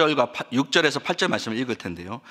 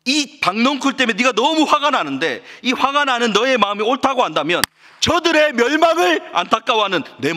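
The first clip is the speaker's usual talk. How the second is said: raised, high-pitched impassioned reproach; God pleading impassionedly; 10 semitones higher, 4 dB louder than usual. Acted. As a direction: press Jonah hard as an anguished God. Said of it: "하나님께서 이렇게 지금 이야기하는 거죠". (격앙되어 호소하는 하나님 목소리)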